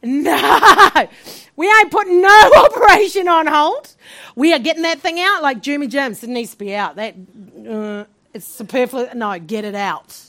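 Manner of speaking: loud, high, laughing refusal; defiant laughing outburst. Retold or imitated. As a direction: laugh it off while flatly refusing. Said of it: "I'm like".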